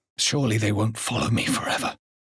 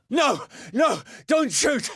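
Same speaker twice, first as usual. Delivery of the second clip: sharp, much higher and louder cries of panic; panicked shout; 4 dB louder, slower than usual. Acted. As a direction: yell in terror for them to stop firing. Panicked plea at gunpoint.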